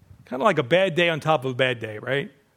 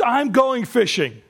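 slow, raised announcement; declarative quotation; 4 semitones higher, slower than usual. Acted. Preacher quotes Peter from John twenty-one, declaring. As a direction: announce it slowly and higher. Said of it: "Peter says".

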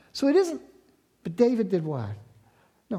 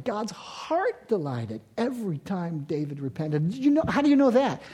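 usual speaking voice

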